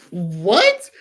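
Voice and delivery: stunned at what dad demanded; shocked what. Real, not acted. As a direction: blurt it out in disbelief. Reacting to dad's cruel order.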